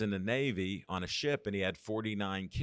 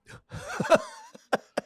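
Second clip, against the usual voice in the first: a sudden very high squeal of a laugh; 5 dB louder than usual. Real, not acted. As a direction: burst out laughing at the insult. Laughs at producer's putdown.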